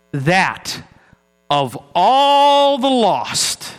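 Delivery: slow, low, solemn poem recital; poem reading. Acted. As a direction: lay each line down slowly and gravely. Reads Browning poem aloud.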